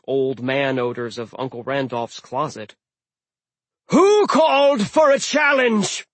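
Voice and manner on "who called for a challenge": slow booming giant shout. Acted. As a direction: boom it like a giant captain. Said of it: "Hrym boomed".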